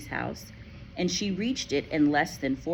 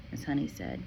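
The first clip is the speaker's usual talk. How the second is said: plain reading voice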